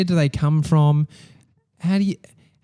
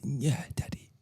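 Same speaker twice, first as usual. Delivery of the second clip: put-on tiny, very soft child voice; timid little kid; 13 dB quieter than usual. Dad voicing his son agreeing to search, hushed child voice. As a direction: answer softly like a small child imitated by Dad. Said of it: "And he's".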